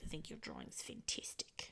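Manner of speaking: soft breathy whisper, stone-faced; deadpan whisper. Retold or imitated. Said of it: "whispery and breathy".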